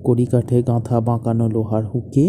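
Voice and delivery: low, measured story reading; story narration. Acted. Writer reading his tragic story to his wife, solemn narration. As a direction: read it low and solemn, letting each phrase settle.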